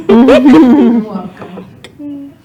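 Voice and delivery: "You're welcome" stretched very slow and loud, laughing; milk the "You're welcome" loud and slow. Shown as a demonstration. Comedian to the audience, loud laughing "You're welcome".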